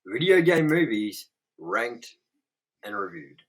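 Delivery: upbeat host welcome, loud at the start; show intro. Acted. Host welcoming the audience to the show, announcer-style. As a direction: open the show like a host greeting fans.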